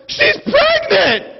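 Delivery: very high, stretched-out squeal; hammed-up shriek of thrilled disbelief; mock overexcited. Lady shares magazine gossip, mock overexcited shriek. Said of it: "she's just so excited".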